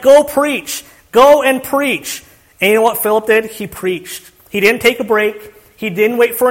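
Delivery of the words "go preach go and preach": higher, louder, slow divine command; commanding voice of God. Acted. Preacher voicing God's urgent command to Philip, higher and louder. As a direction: lay down the order to go and preach.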